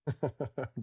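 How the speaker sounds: soft, low, rapid chuckle; quiet string of short laughs. Real, not acted. Amused at battery joke.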